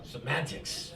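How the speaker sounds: low, soft echo; softly repeated, well under the shriek; quiet aside. Retold or imitated. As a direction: drop it down to a quiet, dry repeat. Comedian repeats mother's word as himself.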